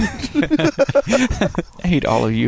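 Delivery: mock sulk. Sulking after teasing.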